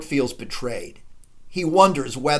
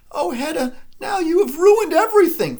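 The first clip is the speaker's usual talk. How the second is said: high, rising, louder dismayed cry; Thea dismayed; 13 semitones higher, 5 dB louder than usual. Acted. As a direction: Thea wailing that all is ruined. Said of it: "Thea says".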